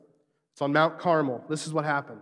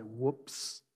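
small, low, soft admission; understated comic oops; 4 semitones lower, 10 dB quieter than usual. Retold or imitated. Humbled prophets on their knees, understated comic oops. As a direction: drop it quietly as a deadpan punchline. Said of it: "and they cry out".

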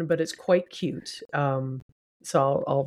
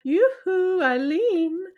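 calling out yoo-hoo; 13 semitones higher, slower than usual. Retold or imitated. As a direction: call out to a friend across the dance floor.

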